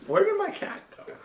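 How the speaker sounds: squeaky high-pitched calling; high and soft, playful; high silly voice. Pretend. Mock search for cat.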